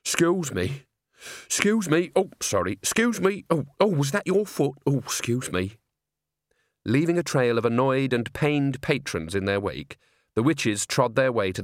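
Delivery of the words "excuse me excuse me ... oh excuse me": bright, fussy apologies down the row; squeezing past people, mock polite; apologetic excuse me's. Nanny pushing along the row.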